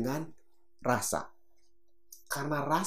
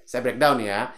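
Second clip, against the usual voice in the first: ordinary talk, nothing marked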